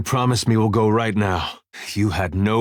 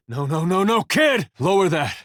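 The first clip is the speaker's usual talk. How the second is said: high, urgent plea to stop; alarmed pleading; 9 semitones higher than usual. Acted. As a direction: climb in pitch as you try to halt them. Urging a kid to lower something.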